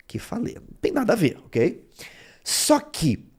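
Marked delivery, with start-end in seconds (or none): 0.1-0.6 kefalê whispered with no voice, soft and airy; whispered repeat. Shown as a demonstration. Teacher repeats the Greek word to the class in a whisper. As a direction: breathe Kefalê out as a hushed whisper.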